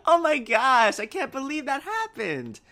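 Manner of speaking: high, hammed-up astonishment; amazed disbelief. Friends reacting to a player's mishap, amazed disbelief. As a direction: gasp it out high in playful shock. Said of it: "you can tell in their tone".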